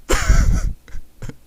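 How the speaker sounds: very high squeaky laugh; disbelieving laugh. Real, not acted. Reader cracks up at the story.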